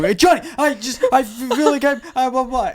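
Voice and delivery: high, loud frantic babble; panicked babbling. Retold or imitated. Naked teen babbling to his brother, high and frantic. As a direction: blurt it out in a panic. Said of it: "I'm going".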